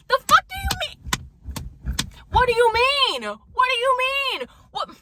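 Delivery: very high, loud squeal of disbelief; outraged shriek. Retold or imitated. Outraged reaction to coworker leaving.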